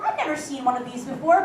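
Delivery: normal talking voice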